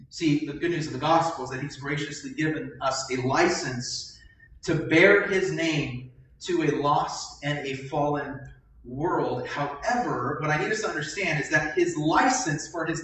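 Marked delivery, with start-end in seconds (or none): none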